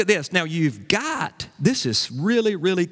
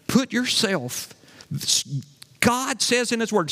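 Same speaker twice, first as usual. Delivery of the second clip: ordinary talk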